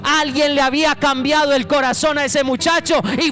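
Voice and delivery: loud, driving preacher's call; fiery altar-call preaching; preaching at full force. Acted. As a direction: press the message home to the congregation.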